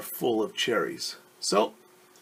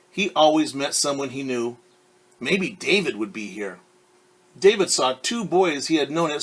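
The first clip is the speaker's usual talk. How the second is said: clear, lively read-aloud voice; storyteller reading a picture book; story narration; 2 semitones higher, 6 dB louder than usual. A narrator's reading. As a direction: read it to a class of kids.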